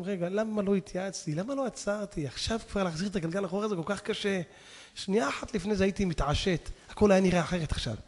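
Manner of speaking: ordinary talk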